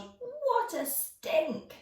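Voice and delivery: high, slow disgusted exclaim. Acted. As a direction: drag it out, appalled by the stink. George voiced disgusted.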